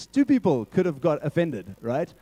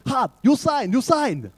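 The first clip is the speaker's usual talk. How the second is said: put-on Chinese accent; 7 semitones higher, slower than usual. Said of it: "he's going".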